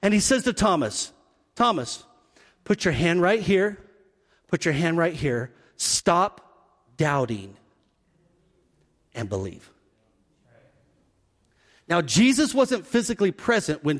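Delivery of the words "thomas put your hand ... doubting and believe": softer and lower, fading to a hush; gentle invitation. Retold or imitated. Jesus telling doubting Thomas to touch, soft and fading. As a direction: invite him gently, trailing off.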